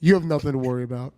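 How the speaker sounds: low, quick mobster reassurance; mock mob boss settling a matter; Tony Soprano. Co-host playing the boss to his assistant, mock gangster reassurance. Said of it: "He comes out like Tony Soprano".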